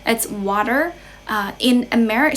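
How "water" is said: American "water" ending in a clear r; American accent. Shown as a demonstration. Teacher contrasting accents for learners, American accent. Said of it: "in American English".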